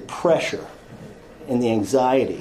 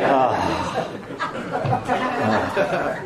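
relieved ah; 3 dB quieter than usual. Retold or imitated. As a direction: let out a giggly sigh of relief. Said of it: "It was like".

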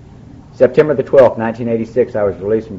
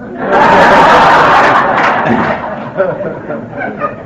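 hearty laugh; 7 semitones higher, 5 dB louder than usual. Real, not acted. Audience laughter, joke.

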